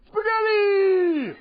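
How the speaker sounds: very high, slow crying shout; mentally challenged wizard. Retold or imitated. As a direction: wail the wrong answer up high. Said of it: "my impression of a mentally challenged wizard".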